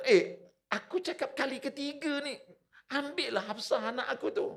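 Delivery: high, exasperated mock demand; acted anger. Umar asks a third time to be answered, angry. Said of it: "Marah dia, betul tak? Dia kata".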